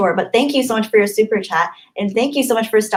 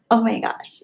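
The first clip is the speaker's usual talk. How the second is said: usual speaking voice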